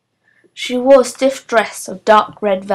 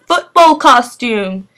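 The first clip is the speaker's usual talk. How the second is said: slow hammed-up mock posh voice; mock her in a silly posh way; 3 semitones higher, 4 dB louder, slower than usual. A narrator's reading. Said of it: "in silly, posh voices".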